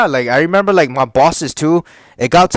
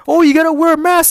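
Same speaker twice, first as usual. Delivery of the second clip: high-pitched mock nagging; mocking, much higher voice; mocking coworker; 13 semitones higher, 4 dB louder than usual. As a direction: mimic a fussy rule-enforcer way up high. Mocking rule-enforcing coworkers.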